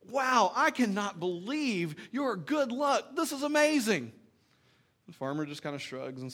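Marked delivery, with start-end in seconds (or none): 0.1-4.1 high, slow, wide-eyed gasp of awe; amazed neighbours. Retold or imitated. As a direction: play the gushing friends, amazed. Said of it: "his friends ... are like".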